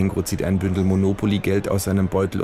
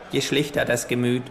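kangaroo voice; 5 semitones higher than usual. Acted. Kangaroo fanning itself with play money, smug higher voice. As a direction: deliver it like a smug know-it-all.

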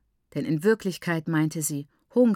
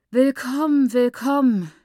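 louder and higher, a sick woman calling out; suffering voice; 6 semitones higher, 7 dB louder than usual. A narrator's reading. Sick mother calling from the sickroom, suffering. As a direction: call the greeting like a martyr from the next room. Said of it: "mit leidender Stimme".

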